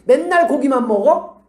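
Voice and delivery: acted peevish outburst; irritated complaint. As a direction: complain as if fed up. Acted complaint about meat dinner.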